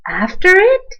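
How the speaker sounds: plain reading voice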